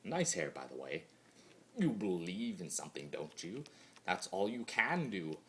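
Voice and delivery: usual speaking voice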